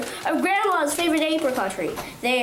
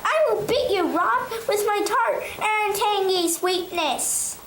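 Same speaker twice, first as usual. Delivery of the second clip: boastful apricot; 6 semitones higher than usual. Acted. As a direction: brag in a high sing-song.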